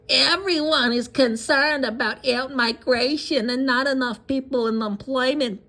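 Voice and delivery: high, slow, mocking caricature voice; mocking put-on voice. Mocking a congresswoman's quote in a high slow put-on voice. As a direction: drag it out high and slow to mock her. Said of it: "I don't know why I'm doing a fucking Shlomo voice on her".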